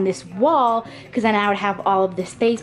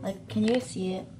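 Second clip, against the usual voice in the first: whispering; 9 dB quieter than usual. Real, not acted. Whispered aside under the bed.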